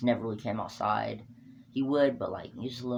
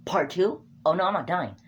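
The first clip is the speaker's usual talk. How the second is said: mock panic; 7 semitones higher, 6 dB louder than usual. Rock about to be thrown.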